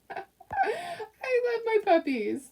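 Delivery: high slow cooing; doting gush. Real, not acted. Telling viewers she loves her dogs, high slow coo. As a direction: gush over your puppies.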